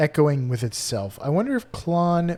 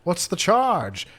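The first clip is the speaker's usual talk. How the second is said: man being arrested; 5 semitones higher than usual. Retold or imitated. Quoting an arrested man from a video, theatrically indignant. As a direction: protest it theatrically, pitch climbing. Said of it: "a guy being arrested, and he's saying".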